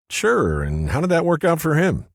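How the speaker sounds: lightly voiced woman, wry; Cynthia, sarcastic. A narrator's reading. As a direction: needle him with a question.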